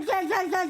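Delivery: imitating whiny sobs, half-mocking; mock sobbing. Teasing the crying woman in front of the police, mock sobbing. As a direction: ham up a woman's blubbering. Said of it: "ಶಾ, ಶಾ ಅಂತ ಅಳ್ಸಕ್".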